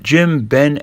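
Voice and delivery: plain reading voice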